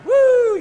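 high, loud victory whoop; triumphant whoop. Retold or imitated. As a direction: whoop like you just won. Retold feeling of winning at sleep. (grito de victoria agudo y fuerte)

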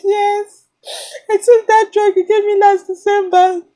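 fake-sob through the words; imitated crying. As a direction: daughter insisting to dad she's fine, mock crying. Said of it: "this is a fake imitation of how I cry".

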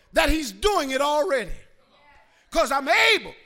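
loud, driving sermon shout; rousing Pentecostal exhortation; full-force preaching. Real, not acted.

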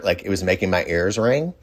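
ordinary talk

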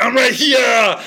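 loud, high Austrian bark; Arnold shout. Retold or imitated. Six-foot-three wizard popping out on stage, shouting in Austrian accent. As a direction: pop out and boom it like Arnold. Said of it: "I have a loud voice".

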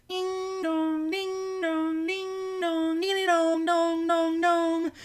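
high sung doorbell chime; doorbell ding dong. A narrator's reading. Narrator imitating the doorbell, high sung chime.

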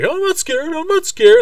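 very high, small put-on child voice; mock-timid falsetto kid; scared little boy. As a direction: squeak it out as self-reassurance. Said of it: "he's like".

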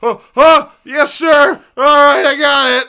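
high, loud, panicky yelp of compliance; frightened shouting yes sir at the cop; panicked. Retold or imitated. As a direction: squeal your yes sir like a terrified kid. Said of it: "It's like".

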